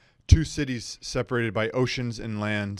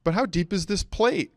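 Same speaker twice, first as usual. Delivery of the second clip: pointed question; 9 semitones higher than usual. Acted. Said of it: "I say to Doshin the waiter".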